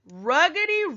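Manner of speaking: ruggedy sung out high and loud, falling at the end; ruggedy tossed up high and loud; lively sound word. Pretend. Teacher reading the poem to her class, ruggedy called out high and lively.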